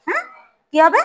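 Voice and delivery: ordinary talk, nothing marked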